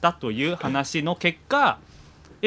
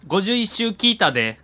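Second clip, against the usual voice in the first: Kansai dialect; 7 semitones higher, 3 dB louder than usual. Acted. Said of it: "関西弁で読ませていただいたんですが".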